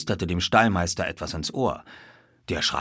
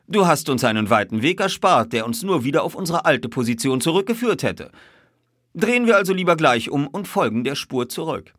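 higher, louder, lively detective; lightly voiced detective; 8 semitones higher, 5 dB louder than usual. A narrator's reading. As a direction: praise your companion, then propose turning back.

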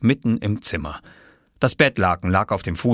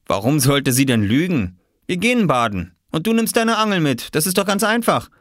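put-on higher-pitched, breezy boy; easygoing friend Viktor; 8 semitones higher than usual. Viktor sorting out plans with Oskar, breezy. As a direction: explain it as if perfectly simple.

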